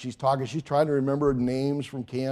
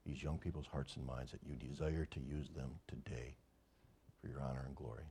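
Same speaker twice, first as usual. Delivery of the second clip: hushed, much softer praying voice; prayer; 9 semitones lower, 16 dB quieter than usual. Real, not acted. Pastor praying softly over the young people, hushed prayer.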